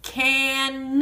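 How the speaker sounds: "Can" held, then an extra punched nuh for the n; final sound separated. Shown as a demonstration. Teacher showing the end sound, "Can" stretched into can-nuh. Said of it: "The na is at the end".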